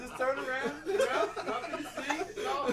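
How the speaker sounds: high giggly voice through laughter; laughing. Real, not acted. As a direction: talk while cracking up. Joking while laughing hard.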